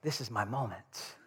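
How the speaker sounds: soft, low inner voice; private resolve. Retold or imitated. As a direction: murmur it low, as if steeling yourself to step in. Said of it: "But I thought".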